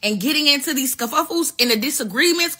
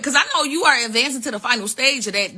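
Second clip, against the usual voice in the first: ordinary talk, nothing marked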